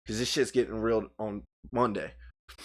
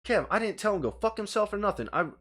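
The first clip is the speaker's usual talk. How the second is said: ordinary delivery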